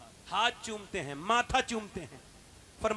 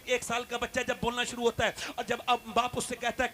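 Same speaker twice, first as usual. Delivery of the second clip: ordinary talk, nothing marked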